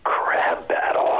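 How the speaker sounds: slow whispered Snake growl; low, slow Solid Snake whisper. Pretend. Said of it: "say crab battle for me in the Snake voice".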